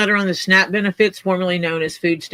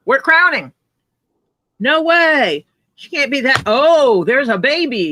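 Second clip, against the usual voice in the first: mock yell, higher pitched; retold yell; 6 semitones higher, 3 dB louder, slower than usual. Dad retelling the birth to the nurse, mock yell. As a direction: call out urgently, imitating the moment. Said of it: "I yelled at the nurse".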